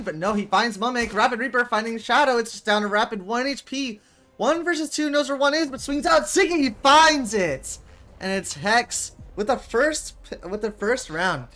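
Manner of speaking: fast esports commentary climbing to the kill; excited play-by-play. Acted. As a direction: call the round like a live caster building hype. Casting the match play-by-play.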